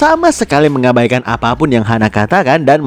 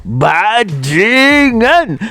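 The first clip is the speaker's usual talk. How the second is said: berteriak marah; 7 semitones higher, much slower than usual. A narrator's reading. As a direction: stretch the curse out in mock fury. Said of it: "sambil berteriak". (teriakan marah yang ditarik panjang)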